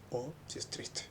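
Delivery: soft voiceless sad whisper on "triste". Shown as a demonstration. Teacher showing sad tone: soft sad whisper. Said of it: "Por ejemplo".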